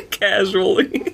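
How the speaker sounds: slow, high, laughed-out word; laughing. Real, not acted. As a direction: crack up while saying it. Cracking up at own joke.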